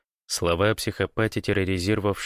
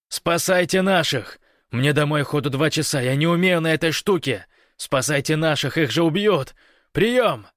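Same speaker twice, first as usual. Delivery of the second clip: high, loud, straining plea; desperate yelling; 8 semitones higher, 5 dB louder than usual. A narrator's reading. Гош begs comrades to save their own, yelling desperately. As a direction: yell for help as if lives depend on it. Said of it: "заорал Гош, срывая голос".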